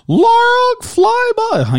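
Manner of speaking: very high, drawn-out mock commentator call; hoped-for broadcast call. Co-host showing the baseball call he hopes to hear, mock announcer cry. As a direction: belt it out high, loud and slow. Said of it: "hoping that I can hear".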